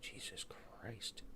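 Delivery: slow whispered Jesus Christ; exasperated whisper. Real, not acted. Frustrated trader whispers at a broken app. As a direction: breathe it out in disbelief.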